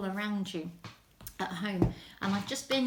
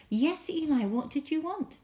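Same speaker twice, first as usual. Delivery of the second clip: put-on higher, eager child asking; eager boy; 7 semitones higher, 4 dB louder than usual. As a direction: ask brightly and quickly. Boy runs calling to Eli.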